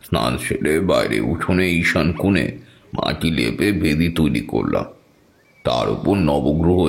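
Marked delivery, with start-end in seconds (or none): none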